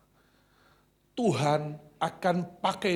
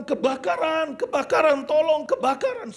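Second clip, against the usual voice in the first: high, loud alarm cry of fire; panicked shout; 13 semitones higher, 11 dB louder than usual. Retold or imitated. Nurse runs through the ward yelling fire, panicked shout. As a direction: scream for help as if the room is burning.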